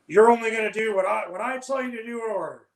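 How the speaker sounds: put-on loud, bossy young officer. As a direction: bark it like a green officer.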